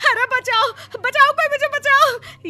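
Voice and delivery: high, loud, terrified shrieks; panicked cries for help. Acted. Woman finding snakes around her bed, panicked. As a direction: scream for rescue.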